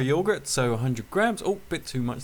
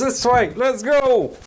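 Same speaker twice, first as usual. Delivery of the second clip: bright, higher, louder keen voice; eager date; 8 semitones higher, 6 dB louder, much slower than usual. Acted. Man taking his date home, excited. Comic sketch character.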